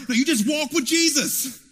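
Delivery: ordinary delivery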